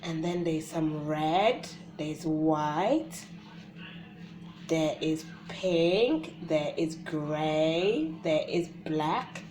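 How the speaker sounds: loud, slow drawn-out colour naming; naming colours to a baby. Shown as a demonstration. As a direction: stretch each colour for a baby.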